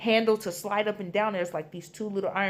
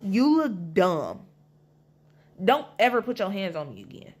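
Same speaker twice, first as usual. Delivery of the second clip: smug taunt. Retold or imitated. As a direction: talk down to her like she's nothing. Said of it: "the asshole in me was like".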